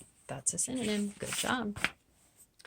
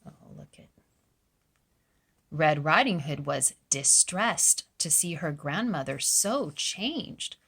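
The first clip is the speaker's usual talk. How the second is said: plain reading voice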